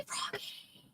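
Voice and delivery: Froggy whispered like a shout, no voice; Froggy as a silent shouted whisper; whisper yelling. Real, not acted. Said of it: "but whisper, scream again".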